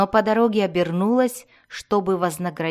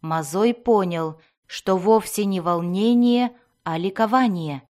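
ordinary delivery, nothing marked

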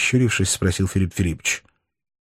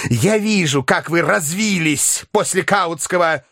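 a touch of a squeal, slightly higher; mild shrill shout; 9 semitones higher, slower than usual. A narrator's reading. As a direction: shriek mildly in spite. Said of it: "визгливо и пожелтев крикнул".

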